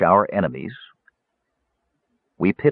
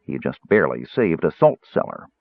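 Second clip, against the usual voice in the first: usual speaking voice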